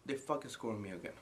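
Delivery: very soft, low disbelief; hushed calm before the rage; stunned quiet fury. Acted. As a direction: say it under your breath, seething. Stunned after being scored on.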